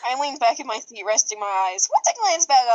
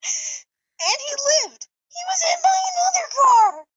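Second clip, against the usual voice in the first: laughing shout; 14 semitones higher than usual. Acted. Chip gleefully insisting Lucky survived, laughing shout. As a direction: shout it, cracking up.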